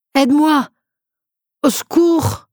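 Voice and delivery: high, loud desperate cry in French; cry for help. Acted. Patient in pain calling nurses for help, desperate loud cry. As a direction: shout it out in agony.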